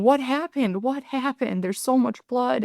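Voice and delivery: high, panicked yelling; frantic yell. Retold or imitated. Mother yelling at her husband over the injured child, panicked. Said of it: "I was just yelling at Brandon".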